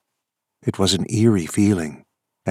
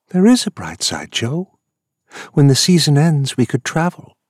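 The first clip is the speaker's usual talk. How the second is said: eager; 8 semitones higher, 5 dB louder than usual. Acted. Lola eagerly pitching a trip.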